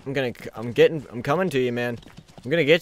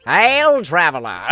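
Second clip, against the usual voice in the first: loud mimicry of a game character's greeting, pitched up then dropping; voice actor impression; 3 semitones higher, 7 dB louder, slower than usual. Player mocking the game's voice actor, hammed-up loud impression. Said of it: "I love voice actors".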